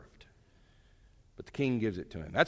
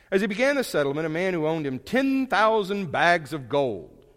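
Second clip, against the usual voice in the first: preached parable; 6 semitones higher, 8 dB louder than usual. Acted. Reading parable with force.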